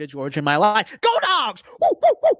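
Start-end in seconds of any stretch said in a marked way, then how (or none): 1.0-1.6 high, whooping fan yell; hammed-up rallying cry; sports fan cheer. Cheering for Georgia's team. 1.8-2.3 three loud, very high whoops; short, high woofing cheers; mock dog-bark whoops. Mimics the team's dog bark.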